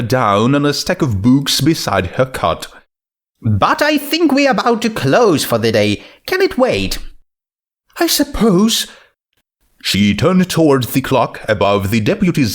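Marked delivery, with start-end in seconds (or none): 3.4-8.9 Twilight voice. Acted.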